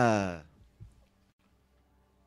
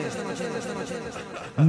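echo whisper; 2 semitones lower, 4 dB quieter than usual. Acted.

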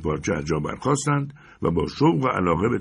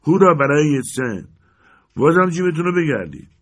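higher, louder jeering cheer; cheering crowd; 8 semitones higher, 6 dB louder than usual. Acted. Crowd jeers at the chairman with a mocking cheer. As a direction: rouse the hall with mock cheers.

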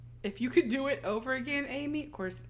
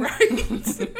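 laughing; 13 semitones higher, 6 dB louder than usual. Real, not acted. Laughing at cohost joke.

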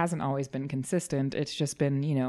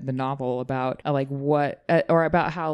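ordinary talk

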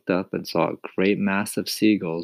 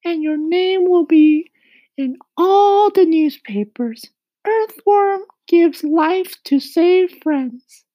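very high loud slow headline voice; Miss Spider voice; 10 dB louder, slower than usual. A narrator's reading. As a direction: announce it grandly, much higher.

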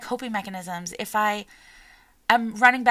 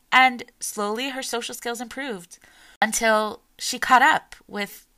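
normal talking voice

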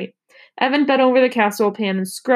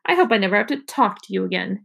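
ordinary delivery

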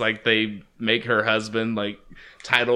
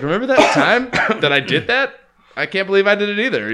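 higher, laughing bragging quote, fast at the end; laughing boast; 8 semitones higher, faster than usual. Retold or imitated. Friend imitating the pilot bragging, laughing. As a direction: brag through a laugh. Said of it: "he's just like".

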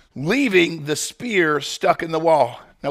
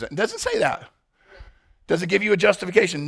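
usual speaking voice

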